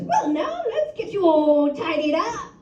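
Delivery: high, prim, proper gentleman voice; posh gentleman. Retold or imitated. She imitates her polite lover in a prim, high gentleman voice. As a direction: play him as a genteel old-fashioned gent.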